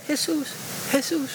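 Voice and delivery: softly murmuring Jesús, Jesús; barely-there Jesús, Jesús; quiet mock murmur. Preacher mimics timid prayer, soft murmur. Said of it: "No estaba diciendo".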